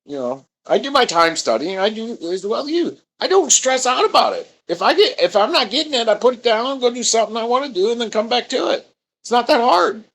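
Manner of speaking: high, breezy mock self voice; carefree self. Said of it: "I'm like".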